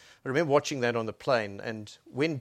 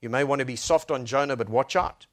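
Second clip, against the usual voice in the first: ordinary talk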